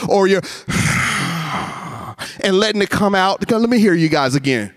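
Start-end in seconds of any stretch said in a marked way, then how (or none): 0.5-2.4 tight-throated, audible sigh; demonstrated sigh. Shown as a demonstration. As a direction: squeeze the breath out as a sigh. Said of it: "make a sigh sound by tightening up".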